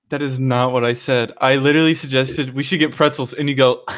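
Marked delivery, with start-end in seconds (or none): none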